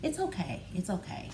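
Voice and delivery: a daughter quietly calming her mom; soothing daughter. Retold or imitated. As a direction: comfort a tearful mother gently. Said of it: "she'll be like".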